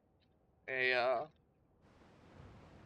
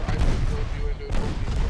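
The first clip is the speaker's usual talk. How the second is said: louder exasperated self-blame; frustrated; 13 dB louder than usual. Real, not acted. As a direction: hammer home the blunder, louder. Frustrated after hitting torpedo.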